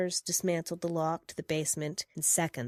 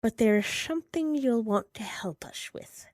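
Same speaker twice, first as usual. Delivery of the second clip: whispered lion voice; 5 semitones higher than usual. Acted. Lion's voice continues, whispered.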